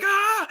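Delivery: high-pitched freak-out "ah"; short, high panicked cry, played for laughs; mock panic scream. Acts out freaking out in bed.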